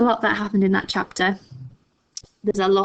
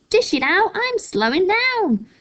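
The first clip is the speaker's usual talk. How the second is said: put-on screechy old grandma; 11 semitones higher, 3 dB louder than usual. A narrator's reading. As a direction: wail it out impatiently. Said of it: "she yelled".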